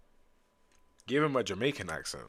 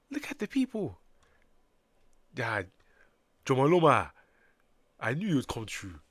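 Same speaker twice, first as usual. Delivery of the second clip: put-on African accent; 3 semitones higher, 4 dB louder than usual. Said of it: "I made the Sumerians African".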